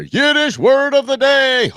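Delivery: high, loud, slow jingle voice; announcer drop-in. Pretend. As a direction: boom it like a show bumper. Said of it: "We should have drop-ins right there".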